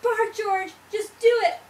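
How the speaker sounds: exasperated command. A narrator's reading. Mama dog orders George to bark, loudly exasperated. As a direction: order the pup loudly, word by word.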